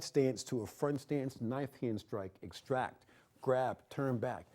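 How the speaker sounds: calling the moves softly while running the form; quiet demo count. Shown as a demonstration. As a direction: murmur the steps as you do them. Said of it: "demonstrating form moves".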